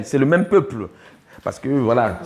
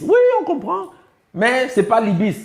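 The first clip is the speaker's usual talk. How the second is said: higher pitch, "ibis" pushed back firmly; emphatic correction; 9 semitones higher than usual. Real, not acted. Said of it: "les Égyptologues vont vous dire ibis".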